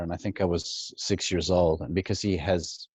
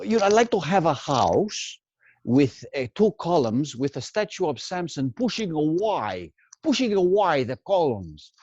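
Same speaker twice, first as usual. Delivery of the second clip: put-on higher, heavy Greek accent with stretched A's; 7 semitones higher than usual. Imitating Greek immigrants with leaned-on A's. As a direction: imitate a Greek dad dreaming of a columned house. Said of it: "he would really emphasize the A's".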